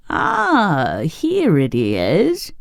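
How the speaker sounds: drawn-out, swooping witchy delight; slow, gloating cry of joy on finding it; joyful cry. A narrator's reading. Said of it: "she cried joyfully".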